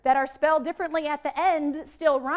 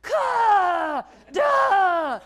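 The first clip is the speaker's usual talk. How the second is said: "Ka, da" shouted loud with an open vowel; big shouted sounds; 3 semitones higher, 7 dB louder than usual. Shown as a demonstration. Showing teachers the big sounds, shouted. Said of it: "make those sounds big".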